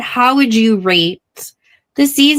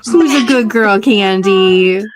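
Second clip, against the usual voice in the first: talking to a dog; 2 semitones higher, slower than usual. Real, not acted. Greeting the dog.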